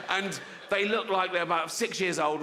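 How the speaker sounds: usual speaking voice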